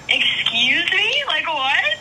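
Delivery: high, drawn-out "excuse me?"; incredulous, stretched-out double take; shocked disbelief. Retold or imitated. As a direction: re-enact stunned disbelief, pitch soaring. Reacting to surprise Opry news.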